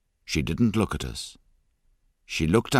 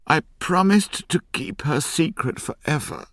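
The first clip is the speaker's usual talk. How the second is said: tearful promise; 10 semitones higher, 3 dB louder than usual. A narrator's reading. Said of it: "she cried".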